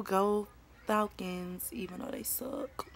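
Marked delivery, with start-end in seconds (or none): 1.7-2.9 soft, unvoiced sneaky aside; whispered aside. Real, not acted. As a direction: whisper it behind your hand. Muttered aside about her team.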